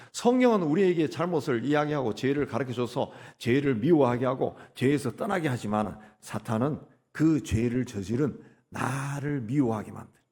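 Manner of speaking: ordinary talk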